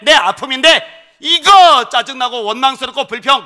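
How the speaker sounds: loud, high, building exhortation; fervent preaching. Acted. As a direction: preach the blessing at full force. Pastor's closing exhortation.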